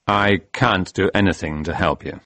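detached, subdued character voice; coldly. Acted. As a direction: refuse coldly with no lift in the voice. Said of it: "said Case coldly".

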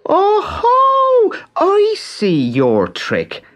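high, loud mocking crow; jeering. A narrator's reading. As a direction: jeer at the trickster. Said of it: "Pat jeered".

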